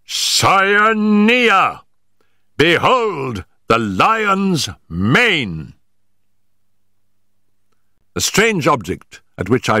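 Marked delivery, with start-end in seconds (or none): 0.1-5.7 high, drawn-out exclamation, slowly proclaimed; triumphant cry. A narrator's reading. Searcher shows his companions the creature, triumphant cry. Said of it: "I cried".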